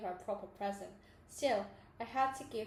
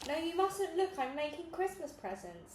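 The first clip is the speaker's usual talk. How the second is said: ordinary delivery, nothing marked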